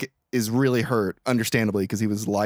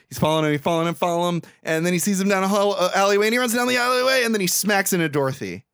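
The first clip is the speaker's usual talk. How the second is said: building excitement; 7 semitones higher, faster than usual. Real, not acted. Recounting Frank's alleyway chase, excitement building. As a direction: pitch climbing breathlessly through the chase.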